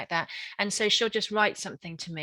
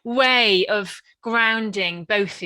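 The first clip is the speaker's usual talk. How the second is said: laughing; 3 semitones higher, 9 dB louder, much slower than usual. Real, not acted.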